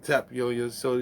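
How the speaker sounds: usual speaking voice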